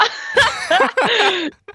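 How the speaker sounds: high, bright burst of laughter; laughing burst. Real, not acted. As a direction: crack up at your own silly idea. Laughs at own mermaid joke.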